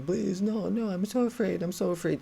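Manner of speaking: a pleading whine on afraid; whining. Retold or imitated. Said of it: "she again starts whining".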